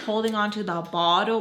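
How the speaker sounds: usual speaking voice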